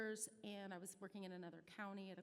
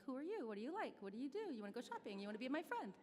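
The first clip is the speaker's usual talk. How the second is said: higher, louder, eager barrage of questions; nosy rapid-fire questions; 5 semitones higher, 5 dB louder than usual. Retold or imitated. Mom grilling her son's girlfriend with eager questions. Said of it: "I'm like".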